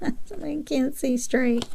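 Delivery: high, slow punchline voice; silly joke voice. Pretend. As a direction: stretch the pun out high and slow. Punchline of a bad pun.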